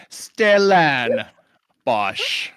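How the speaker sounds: "Stellanbosch" very slow, stretched on LAN; "Stellanbosch" spelled out slowly, LAN held long; drawn-out announcement. Shown as a demonstration. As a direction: draw out "Stellanbosch", pausing before the last syllable. Announcing the new pun name reluctantly.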